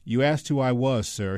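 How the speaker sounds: usual speaking voice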